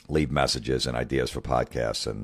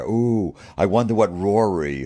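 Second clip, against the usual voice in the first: mock gushing fan; 5 semitones higher, 3 dB louder than usual. Mocking golf tradition fans.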